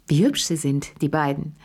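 ordinary delivery, nothing marked

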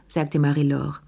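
ordinary delivery

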